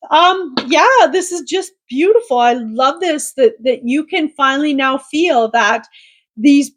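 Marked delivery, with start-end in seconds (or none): 0.1-2.4 higher and quick, full of emotion; moved and excited. Real, not acted. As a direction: gush over how beautiful it is. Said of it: "I'm getting emotional".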